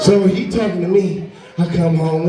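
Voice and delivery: ordinary talk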